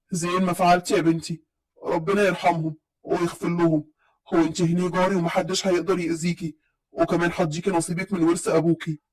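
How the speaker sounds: lightly voiced grandpa promising protection; kind old grandfather. A narrator's reading. Grandfather comforts her. (بصوت جد واطي وهادي ومطمئن)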